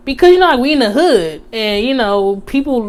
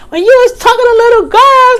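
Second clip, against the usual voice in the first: much higher, louder and fast yell; retold yell; 13 semitones higher, 5 dB louder, faster than usual. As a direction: scream it at him, fully heated. Accusation escalates to a yell.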